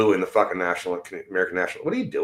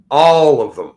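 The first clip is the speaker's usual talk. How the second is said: All of them again, much louder and higher, stressed on All; louder repeat; 5 semitones higher, 9 dB louder, slower than usual. Real, not acted. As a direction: shout the repeat, leaning on All. Ranting at the government.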